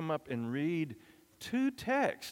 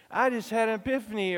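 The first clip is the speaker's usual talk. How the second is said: excited; 8 semitones higher than usual. Shown as a demonstration. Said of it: "you say".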